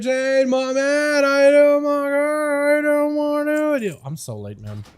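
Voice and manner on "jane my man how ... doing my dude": high, very slow hype greeting to a viewer; real delight at a viewer's alert, pitched way up; excited greeting. Real, not acted. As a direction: sing out the welcome, higher and higher.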